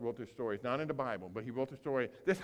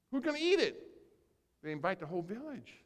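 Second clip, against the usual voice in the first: ordinary talk, nothing marked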